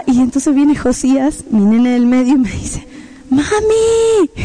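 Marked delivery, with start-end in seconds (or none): 3.3-4.3 put-on high child's call of "Mami"; child calling mom. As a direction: call out like a small boy. Middle son calling mom.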